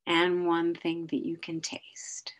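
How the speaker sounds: slow, calm grounding guide; guided meditation. Acted. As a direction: lead them slowly through the senses. Leads a grounding exercise.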